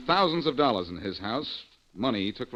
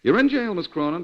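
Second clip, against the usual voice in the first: louder and much higher, rubbing it in; triumphant taunt; 8 semitones higher, 9 dB louder than usual. Acted. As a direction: throw the win in her face. Taunting the jailed woman.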